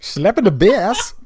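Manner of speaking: high, slow, loud Irish leprechaun voice; hammed-up Irish brogue; leprechaun accent. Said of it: "That was a leprechaun".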